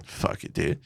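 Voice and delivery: unvoiced fuck it, dude; whispered resignation. Deciding to let the seat thing go, whispered. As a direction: give up in a whisper. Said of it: "And I was like".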